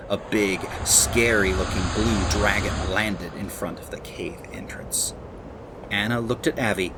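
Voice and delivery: gentle read-aloud storybook voice; lively children's storyteller; story narration. Acted. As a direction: read it like a bedtime story to kids.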